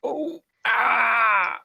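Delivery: loud, high drawn-out death cry; dying scream. Acted. Game master plays a dwarf killed by axe, dying scream. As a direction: wail as the axe lands.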